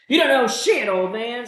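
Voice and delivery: put-on high, loud teenage sneer; defiant teenager. Teenager mouthing off at an old man, loud and defiant. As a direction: mouth off like a bratty teen. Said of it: "says the defiant teenager".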